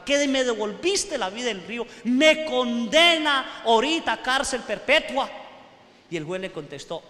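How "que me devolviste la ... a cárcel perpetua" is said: rising into a loud, higher, desperate protest; frightened plea. Acted. Frightened prisoner begging the judge who saved him, loud and high. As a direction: plead with the judge in alarm. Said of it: "todo asustado".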